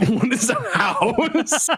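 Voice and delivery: high, slow, drawn-out bafflement; baffled character. Acted. Game master voicing a baffled listener, high and slow. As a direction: stretch it high with laughter underneath.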